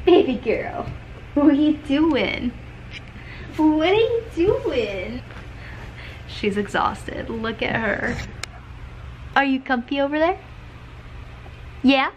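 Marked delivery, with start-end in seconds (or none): 0.1-2.5 higher, drawn-out coo to a dog; cooing pet-talk. Real, not acted. Owner checking on her baby girl, cooing. 3.6-5.2 cooing pet-talk. Real, not acted. Owner asking the tired dog what she's doing, cooing. 9.4-12.1 high, quick doting question; pet-talk. Real, not acted. Owner asking her tired dog, doting pet-talk.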